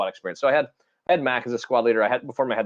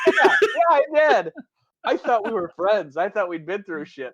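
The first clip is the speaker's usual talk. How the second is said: laughing mock hurt; 11 semitones higher than usual. Quoting a caller laughing.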